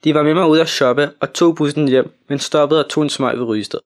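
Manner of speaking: ordinary delivery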